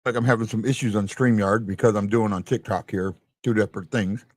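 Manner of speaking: talking through a mouthful of food; chewing while talking. Real, not acted. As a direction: mouth full, chewing between words. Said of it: "sorry for the eating".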